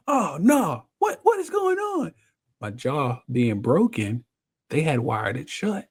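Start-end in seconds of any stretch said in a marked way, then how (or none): 0.1-2.1 high panicked cry, much higher. Retold or imitated. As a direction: blurt it out in a panic on waking blind. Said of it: "now I'm going through this panic phase".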